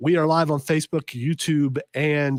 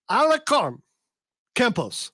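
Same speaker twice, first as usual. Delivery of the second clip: Colombian accent; 6 semitones higher than usual. Shown as a demonstration. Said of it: "I like how our Colombian accents".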